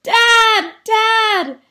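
high, loud call for help; shouted. Acted. Jasper calls Dad to his bedroom, shouting. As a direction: yell out in alarm. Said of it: "he shouted".

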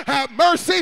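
loud pleading call; cry for mercy. Retold or imitated. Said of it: "So he cried out".

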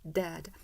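dad with a wide, stretched ae; dad said with a long open vowel; big open dad. Shown as a demonstration. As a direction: push the lip corners out on dad. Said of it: "다시 한 번만".

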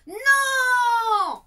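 fists clenched, a high, loud NO; furious shouted no; defiant cry. A narrator's reading. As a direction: hurl the refusal at the dragon. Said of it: "serra les poings très fort et s'écria".

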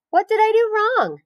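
high, puzzled piglet voice; bewildered piglet. Acted. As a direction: ask it dazed after a tumble. Pig after falling.

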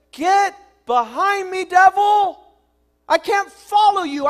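loud, high, forceful command; stern rebuke. Acted. Jesus rebuking the devil, loud and forceful. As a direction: drive the devil back with every line.

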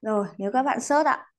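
ordinary talk, nothing marked

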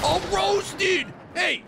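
high-pitched gloating yell; overexcited shout. Real, not acted. Gamer celebrating a hit on an opponent, overexcited high shout. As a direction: holler it, carried away with excitement. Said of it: "I'm getting way too excited".

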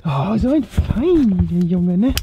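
slow praising doggy talk. Real, not acted. Praising the dog.

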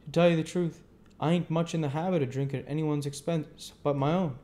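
a hint of easygoing Joe; Joe the blacksmith. A narrator's reading. Joe declines the offer.

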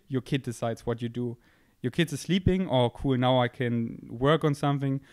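normal talking voice

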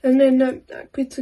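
talking between bites; chewing while talking. Real, not acted. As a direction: mouth partly full. Seen 0: Bite taken at -2.0; mouth working while talking, next bite at 2.3.

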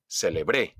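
"celebré" punched on the final BRÉ; stress on final syllable. Shown as a demonstration. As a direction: land on BRÉ ending "celebré". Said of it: "Donde yo hago el acento". ("celebré" con golpe de voz en BRÉ)

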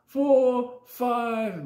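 high, loud halting count; high counting boy. Retold or imitated.

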